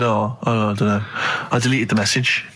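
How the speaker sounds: low dopey mumble; deep offhand mock excuse; imitating a friend.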